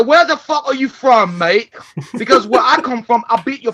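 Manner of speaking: loud, high London accent, hammed up; loud put-on London accent demanding where he's from; mock London accent. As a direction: snap it in a cartoon London accent. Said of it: "You sound like you're from London".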